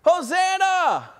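high, loud drawn-out cry of Hosanna; crowd shouting Hosanna. Retold or imitated. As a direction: call it out like the cheering crowd. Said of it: "They were saying".

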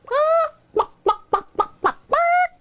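high, staccato chicken squawks; hen clucking. Acted. Henrietta replying to the hens, high chicken clucking. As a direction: cluck in quick high bursts like an excited hen. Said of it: "Henrietta hopped and replied".

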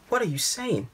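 high-pitched, disbelieving question; bewildered question. A narrator's reading. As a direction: ask your father in high-pitched confusion. Confused boy questions his father.

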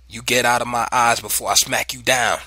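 loud, angry father's threat; disgusted bellow. A narrator's reading. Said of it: "my father bellowed in disgust".

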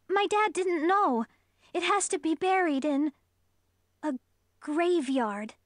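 put-on high, urgent young girl; scared friend pleading; frightened girl voice. A narrator's reading.

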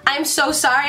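ordinary delivery

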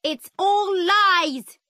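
high, drawn-out shouted accusation; slow, high-pitched furious yell; angry shout. A narrator's reading. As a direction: shout it out in outraged protest. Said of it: "my mother shouted".

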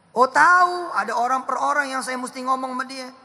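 high, loud preaching burst; excited shout. Real, not acted. Preacher to the congregation, a loud high shout. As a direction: fire up the crowd with a sudden cry.

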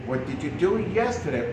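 ordinary talk

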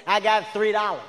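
usual speaking voice

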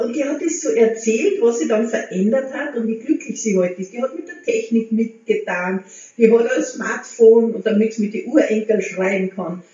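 ordinary delivery

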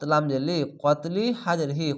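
ordinary talk, nothing marked

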